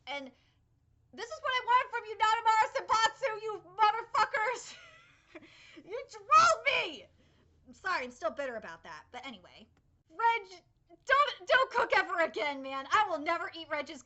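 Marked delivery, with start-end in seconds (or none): 1.1-4.7 fast, loud, higher playful rant; mock angry yell. Real, not acted. Yelling at the trolling studio. 5.8-7.0 much louder, higher playful accusation; mock angry yell. Real, not acted. Yelling at the studio. 10.1-12.8 loud scolding. Real, not acted. Scolding the character.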